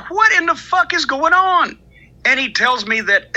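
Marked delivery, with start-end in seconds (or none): 0.1-1.8 high, incredulous question on the phone; exasperated disbelief. Retold or imitated. Answering the early phone call, high and incredulous. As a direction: pitch it up, baffled and fed up. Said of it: "I said".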